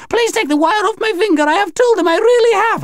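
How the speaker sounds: very high, frantic alien plea; high-pitched desperate begging; desperate pleading. Acted. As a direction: beg for release, shrieking high. Alien aunt pleads for release.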